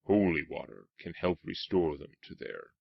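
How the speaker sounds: hint of an ominous storyteller; attempted game narrator. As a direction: try a measured, portentous narrator read. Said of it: "Haven't got that narrator's voice yet".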